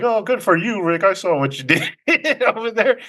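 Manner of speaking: high-pitched retold congratulations; lightly acted delighted congratulations; congratulating. Waiter congratulates Rick in retold scene.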